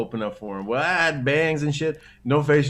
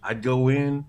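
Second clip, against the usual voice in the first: ordinary talk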